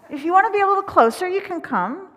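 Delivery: bright call to come closer; eager invite. Real, not acted. Minister inviting children up front, eager and loud.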